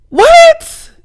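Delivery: high, loud shocked "What?"; stunned squeal; shocked shriek. Real, not acted. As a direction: jump up in disbelief. Shocked reaction to tweet.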